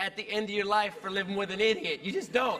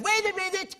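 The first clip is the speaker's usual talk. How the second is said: high, urgent call to stop; urgent inner voice; 8 semitones higher than usual. Acted. Inner voice stopping him leaving, high and sudden. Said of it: "a little voice goes".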